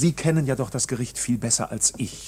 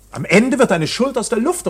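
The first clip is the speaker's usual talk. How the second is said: loud indignant outburst; 8 semitones higher, 9 dB louder than usual. Acted. Accused man to the painter, a loud indignant outburst. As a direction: voice jumps higher and louder in protest.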